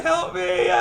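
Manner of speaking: very high, thin cry for help; comic high-pitched plea; mock helpless plea. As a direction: squeal like a stuck turtle, hammed up. Acted plea of stuck turtle.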